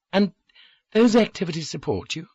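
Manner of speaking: lightly voiced woman, doubting and surprised; incredulous. A narrator's reading. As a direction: ask it as if you can't believe it. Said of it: "She sounded incredulous".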